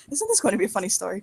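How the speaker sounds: ordinary talk, nothing marked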